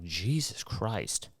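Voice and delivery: soft, slow dismayed groan; a muttered, slow disbelief at the number; exasperated. Retold or imitated. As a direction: drag it out, quietly appalled. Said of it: "And I'm like".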